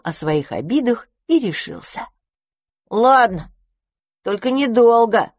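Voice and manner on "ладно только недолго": ordinary delivery, nothing marked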